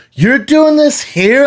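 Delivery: very high, drawn-out mimicked indignation; incredulous outrage. Girlfriend dumped in a packed restaurant, incredulous outrage. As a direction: stretch it out in a high, scandalized voice.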